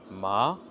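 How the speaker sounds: loud MA rising like a question; second tone, astonishment. Shown as a demonstration. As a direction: lift ma in surprise. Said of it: "on refait".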